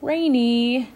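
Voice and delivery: calling out. A narrator's reading. Nimbus catches up to Rainy, calling out. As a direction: call to get someone's attention. Nimbus calls to get her attention.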